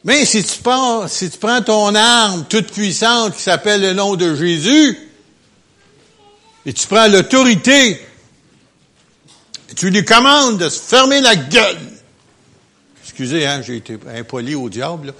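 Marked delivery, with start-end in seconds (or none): 0.0-11.8 higher and louder, preaching with force; fiery preaching. Real, not acted. Preacher urges congregation to command the devil, forcefully. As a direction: rouse them to take authority.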